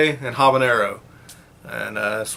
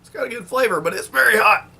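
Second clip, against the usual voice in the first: strained by heat; 9 semitones higher than usual. Real, not acted. Just bit a very hot wing.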